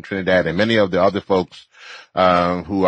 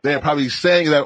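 usual speaking voice

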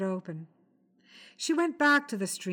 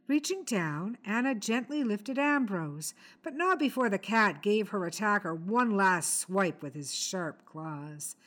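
usual speaking voice

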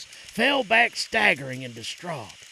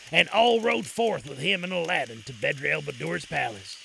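usual speaking voice

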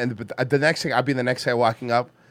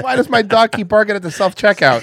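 higher, louder faux-innocent question; mock town hall question; 5 semitones higher, 6 dB louder than usual. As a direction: ask it like a troll at the mic. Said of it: "The next guy that goes up".

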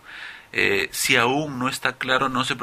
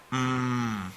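long, softer hum; voiced "mm"; 6 dB quieter than usual. Shown as a demonstration. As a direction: hold a steady humming "mmm". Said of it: "hagan lo mismo y repitan".

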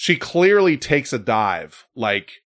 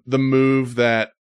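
normal talking voice

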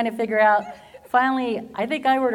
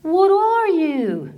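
high, slow, puzzled question; curious counter guy; 10 semitones higher, 5 dB louder, much slower than usual. Retold or imitated. Counter guy asking her origin, high and slow. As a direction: stretch it out, high and baffled. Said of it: "And he goes".

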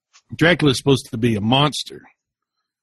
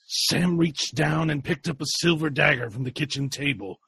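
normal talking voice

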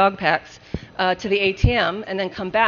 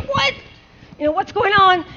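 startled what; 10 semitones higher than usual. Retold or imitated. Retold confused looking around.

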